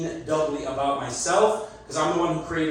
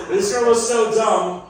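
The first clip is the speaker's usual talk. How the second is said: laughing; 6 semitones higher, slower than usual. Acted. Laughing through catchphrase.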